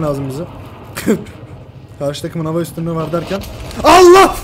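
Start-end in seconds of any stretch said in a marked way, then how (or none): 3.8-4.4 very high, loud outcry; shocked shout. Real, not acted. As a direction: burst out in shock. Sudden death in game. (çok yüksek ve tiz şok çığlığı)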